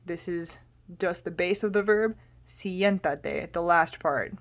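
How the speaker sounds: normal talking voice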